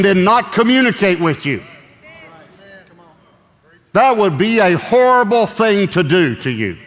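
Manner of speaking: loud, high, fervent preaching; preaching at full force. Acted. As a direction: preach it hard, rising up.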